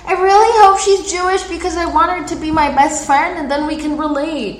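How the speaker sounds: high, loud eager gush; excited gushing. Retold or imitated. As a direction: gush like a hopeful fan. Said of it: "I was just like".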